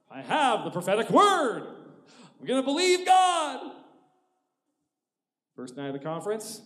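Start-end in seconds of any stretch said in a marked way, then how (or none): 0.1-3.7 loud, high, defiant proclamation; ringing, slow faith claim; loud declaration. Acted. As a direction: shout your faith over the doubt. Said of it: "declaring faith".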